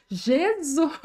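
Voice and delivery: high, bright-hearted retold exclamation; joyful calling out. Woman calls to Jesus at the mirror, joyfully. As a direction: call the name out high, close to laughing.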